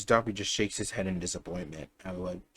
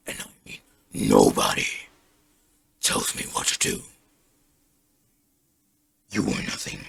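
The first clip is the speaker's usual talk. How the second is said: harsh, whispery villain growl; low rasping threat, spaced out phrase by phrase; menacing threat; 12 semitones lower, 3 dB louder than usual. Acted. Izuku threatening Shigaraki pinned to the wall, menacing rasp.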